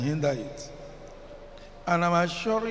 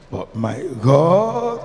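loud, slow drawn-out call; slow loud preaching cry; drawn-out exclamation; 3 semitones lower, 4 dB louder, much slower than usual. Real, not acted. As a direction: stretch it out with fervour. Preacher drawn out, then laughs.

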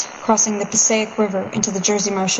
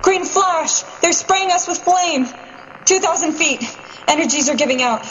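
a pilot crying out reports while diving to his death, high and strained; panicked pilot; 5 semitones higher than usual. Acted. Bomber pilot radioing his last dive into the enemy, high, frantic reports. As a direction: voice climbing high and strained as the plane goes down.

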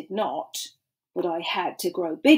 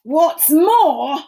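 sharp louder snap from the Lorax; snapped; 6 semitones higher, 6 dB louder than usual. A narrator's reading. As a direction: bite the words off, cross. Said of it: "snapped the Lorax".

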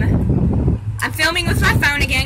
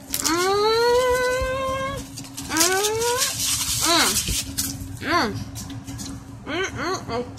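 soft, pleased hums while chewing; savoring mm; 9 semitones higher, 6 dB quieter, slower than usual. Real, not acted. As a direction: hum with pleasure through the bite. Tasting taco, eating sounds.

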